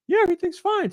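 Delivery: high-pitched breezy reassurance; imitating oblivious dad. Dad answering his worried family, mock-oblivious. Retold dad brushing off concern.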